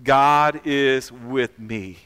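slow, loud group declaration; stretched-out words for all to join in; leading congregation in unison. Shown as a demonstration. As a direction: lead everyone through it slowly and firmly. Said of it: "Everybody say this together".

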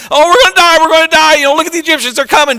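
high, loud mock panic; hammed-up wail of impending doom; panicked crying out. Israelites crying out as the Egyptians come, acted high-pitched panic. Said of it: "they cried out in the wilderness".

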